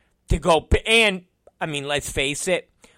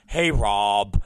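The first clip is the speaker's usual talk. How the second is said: low, dopey mimicry; mocking employee impression; 6 semitones lower than usual. Unreliable employee greeting his boss, low mocking voice. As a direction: imitate a sheepish caller, voice dropped low. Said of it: "the issue was".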